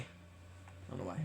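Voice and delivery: breathless whisper, slow. Shown as a demonstration. Repeating what people say, in a whisper. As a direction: whisper it under your breath. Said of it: "I repeat it often what people say".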